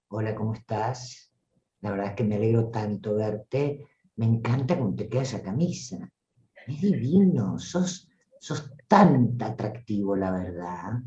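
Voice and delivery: low, soft, flirtatious purr; play-acted seductress, low and intimate; hyper-seductive. Shown as a demonstration. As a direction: flatter them in a hushed, seductive low voice. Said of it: "jugá a ser hiperseductora".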